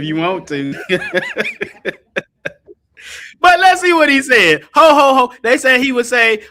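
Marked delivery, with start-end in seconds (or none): 0.0-0.7 laughing quote. Retold or imitated. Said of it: "She said". 0.8-1.9 a burst of high real laughter. Real, not acted. Preacher cracking up at his aunt's dry reply, high laugh. 4.7-5.3 big, loud, higher ho ho ho. Acted. Preacher belting out the devil's laugh for the viewers, loud.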